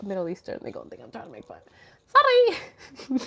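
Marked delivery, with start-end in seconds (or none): 2.1-3.2 high, sing-out sorry; called-out apology. Pretend. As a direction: pipe it up high to the neighbors. Calls apology out to neighbors.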